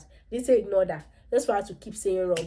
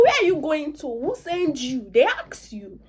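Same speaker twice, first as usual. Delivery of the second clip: mock scolding; 7 semitones higher, 4 dB louder than usual. Scolds Ife for butting in.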